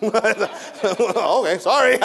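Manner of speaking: high, loud apology through laughter; sorry, half swallowed by laughing; laughing mock apology. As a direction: burst out laughing as you apologise.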